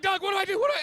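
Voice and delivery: mock panic, calling out to Doug; comic panic asking a friend for help; panicked call to Doug. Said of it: "I'm like".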